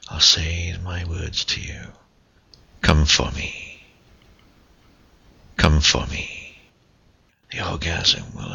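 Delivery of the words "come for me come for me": commanding. Acted. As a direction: give the order, firm and close. Climax of whispered countdown.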